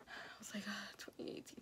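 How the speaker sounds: faint whispered struggle to answer. Retold or imitated. Patient answering the medic's year question, dazed whisper.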